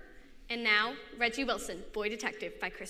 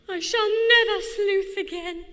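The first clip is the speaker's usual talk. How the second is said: higher, louder dramatic declaration; melodramatic girl voice; 9 semitones higher, 10 dB louder than usual. Acted. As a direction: overwrought theatrical vow. Harriet's melodramatic vow.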